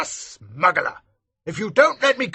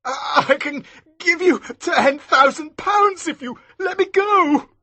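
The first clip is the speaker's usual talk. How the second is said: much higher, louder stammering bribe; voice shaking; 6 dB louder than usual. Acted. Maskew bribing Elsevier, shaking frightened voice. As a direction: stammer out the offer in panic. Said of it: "his voice shaking".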